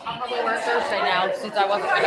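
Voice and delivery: talking around a mouthful of food; words through a bite of food; mouth full. Real, not acted. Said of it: "I have food in my mouth".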